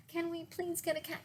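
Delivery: high, fast pleading; quick begging child. Acted. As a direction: rush the plea.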